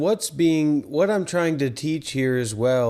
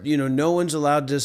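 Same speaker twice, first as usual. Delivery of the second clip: normal talking voice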